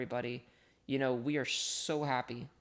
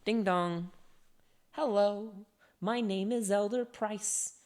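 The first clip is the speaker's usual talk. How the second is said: lightly voiced cheery missionary, a bit higher and louder; Elder Price; 4 semitones higher, 3 dB louder than usual. Quoting the musical to chat, a light eager missionary voice.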